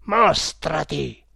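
slow, loud, menacing order; imperious challenge; terrible command. A narrator's reading. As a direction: demand it with a heavy first syllable. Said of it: "gridò Sudoyana con terribile accento".

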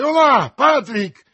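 loud, high shout for a servant; urgent high-pitched call. A narrator's reading. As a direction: holler for help at full voice. Calls his servant for help.

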